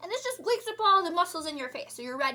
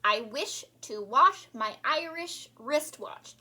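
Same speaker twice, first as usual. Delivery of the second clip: even slower, careful repeat; slow, precise diction demo; slower tongue twister; slower than usual. Shown as a demonstration. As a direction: stretch out every word. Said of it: "let's do it again".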